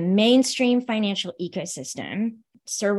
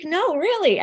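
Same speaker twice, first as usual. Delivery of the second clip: high, playful insistence; mock-protest that it's true; lightly acted no really; insisting; 9 semitones higher, 3 dB louder than usual. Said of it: "And I'm like".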